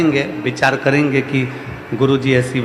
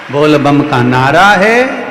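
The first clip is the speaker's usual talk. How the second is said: slogan call; 7 dB louder, slower than usual. Acted. Slogan called to crowd.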